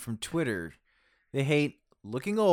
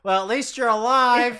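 high, laughing parody of her motto; mock laughing; 10 semitones higher, 8 dB louder than usual. Co-host mocking Lisa's motto, laughing. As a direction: tease her catchphrase while laughing.

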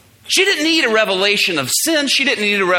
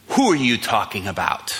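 put-on girl's low voice; 11 semitones lower, 4 dB quieter than usual. Girl voiced in retold story.

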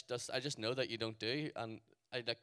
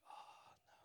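tiny whispered groan of dismay; hushed dread; 21 dB quieter than usual. Retold or imitated. Caught by a street seller, hushed dread. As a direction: let it out under your breath.

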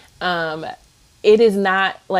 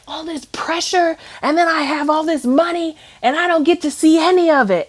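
high-pitched mock complaint; salty boyfriend imitation; 10 semitones higher, 4 dB louder than usual. Girlfriend imitates boyfriend complaining about saving money, salty. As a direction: imitate him griping, pitched well up. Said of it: "basically said that he is salty".

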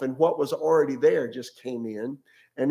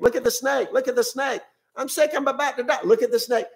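much higher, louder desperate calling across the camp; frantic calling out; 10 semitones higher, 3 dB louder, faster than usual. Retold or imitated. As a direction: cry out like a dying man pleading. Someone way out in camp.

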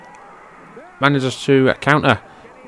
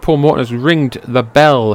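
ordinary talk, nothing marked

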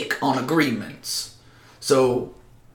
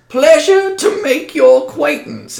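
put-on high, louder old man voice; a hint of an old man, higher and louder; old medicine man; 14 semitones higher, 6 dB louder than usual. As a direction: greet them as a worn old healer, pitched up. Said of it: "And he says".

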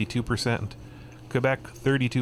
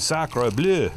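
mock French exclamation; 6 semitones higher, 4 dB louder than usual. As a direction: exclaim it in a hammed-up French accent. Mock French oath about Quebec.

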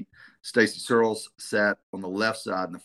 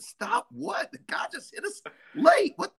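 much higher, stunned disbelief; incredulous outburst. Retold or imitated. Coach retelling his disbelief at the referee's call, squealing high. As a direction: protest in shocked disbelief. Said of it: "I said".

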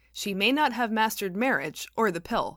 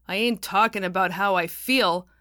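louder, sharp irritated snap; snapping; 3 semitones higher, 7 dB louder than usual. A narrator's reading. George snapping at the pastor, louder and irritated. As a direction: bite back at the pastor. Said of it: "snapped George".

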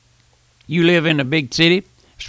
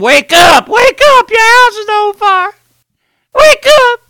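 frantic scream, much higher and louder; 7 dB louder than usual. Retold or imitated. Preacher screaming at a sleeping lady whose house burns, frantic. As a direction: scream her awake through the broken window. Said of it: "I begin to scream".

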